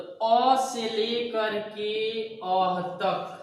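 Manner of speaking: अ and अह drawn out slowly and separately; vowel sounds shown. Shown as a demonstration. Teacher shows Hindi vowel range, अ to अह, very slowly. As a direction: stretch अ, then land on a breath-ended अह. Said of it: "vowel sound Hindi में".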